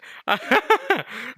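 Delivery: high laughing fit; amused laugh. Real, not acted. Laughing at saying pollination, helpless high laugh. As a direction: crack up, high-pitched.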